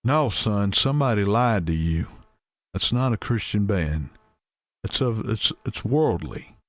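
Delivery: a hint of a scandalised preacher scolding a young man; Brother Wayne voice. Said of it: "use my brother Wayne voice".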